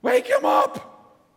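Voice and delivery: very high, loud, drawn-out yell; panicked yell. Retold or imitated. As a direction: scream for help in panic. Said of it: "crying out".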